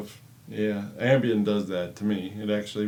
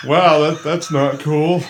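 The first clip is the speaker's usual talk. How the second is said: mock disapproval; 4 semitones higher, 10 dB louder, slower than usual. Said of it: "Just like".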